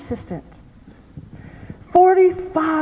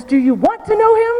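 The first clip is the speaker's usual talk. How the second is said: put-on funny old lady voice; 7 semitones higher than usual. Grandmother confronting the man who left her, high and funny. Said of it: "she talks in this very funny voice".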